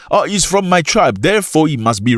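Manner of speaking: mocking the tribe defender. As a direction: sneer it out like a blind supporter. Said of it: "That's a massive problem".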